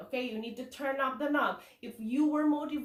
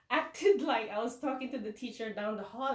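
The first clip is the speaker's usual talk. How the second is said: ordinary talk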